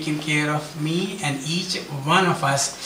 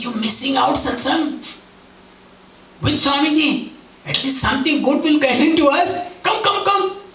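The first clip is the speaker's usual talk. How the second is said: high, pleading coax rising higher; coaxing wife; 9 semitones higher than usual. Retold or imitated. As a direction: urge her to come, voice climbing.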